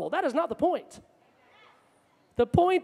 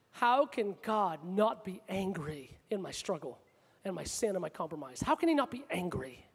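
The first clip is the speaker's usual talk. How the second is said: hushed questioning; 7 semitones lower, 9 dB quieter than usual. Acted. Preacher voices a struggling believer questioning God, hushed. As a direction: ask it almost under your breath, low and halting.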